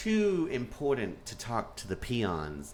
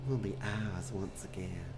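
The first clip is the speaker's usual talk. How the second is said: imitated Southern accent; 6 dB quieter than usual. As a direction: softly echo the Southern-accented line. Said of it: "I love this accent".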